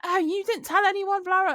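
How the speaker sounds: imitating a complainer in a high, loud voice; high mock scolding, dissolving into blah blah; mocking accusation. Weighbridge worker blaming her for telling nobody, high mock complaint. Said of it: "'cause she was like".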